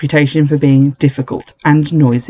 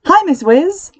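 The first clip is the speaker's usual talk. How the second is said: high, bouncy hi; bright greeting; slower than usual. Shown as a demonstration. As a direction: sing it out cheerfully. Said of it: "You say".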